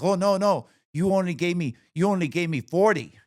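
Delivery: high, loud put-on accent of the masseuse; mock masseuse voice. Masseuse protesting the tip to the mom, high mock accent. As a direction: squeal it back as the defensive masseuse. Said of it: "It's like".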